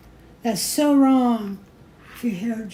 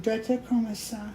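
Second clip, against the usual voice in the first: terrible French accent; 10 dB quieter than usual. Shown as a demonstration. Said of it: "A terrible French accent".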